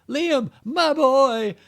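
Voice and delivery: high, slow, loud anguished cry; dramatic wail. Retold or imitated. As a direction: wail it out like heartbroken. Reading dramatic line from review.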